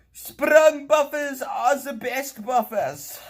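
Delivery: high, loud, slow proclamation; hammed-up announcer. Praising sprung buffers to viewers, in a high grand mock voice. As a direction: declare it like a pompous herald. Enthusing in put-on voice.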